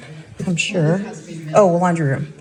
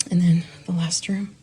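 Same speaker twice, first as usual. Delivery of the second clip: whisper; 6 dB quieter than usual. Real, not acted. Sneaking a look while family talks.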